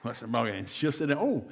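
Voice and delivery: ordinary delivery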